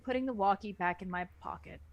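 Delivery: ordinary talk, nothing marked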